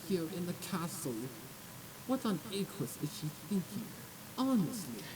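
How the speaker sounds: snooty gossip. Acted. Posh nobles whispering about the filly, snooty and disdainful. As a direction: drip with upper-class contempt.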